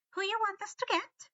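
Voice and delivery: high, gentle elf question; kindly elf. A narrator's reading. Winky kindly asks Harry, high elf voice. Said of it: "Winky asked kindly".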